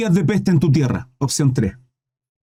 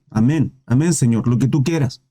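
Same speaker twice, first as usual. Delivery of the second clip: submissive David; 6 semitones lower than usual. Retold or imitated. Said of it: "Se comporta varonilmente".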